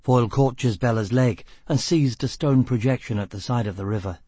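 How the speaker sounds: ordinary delivery, nothing marked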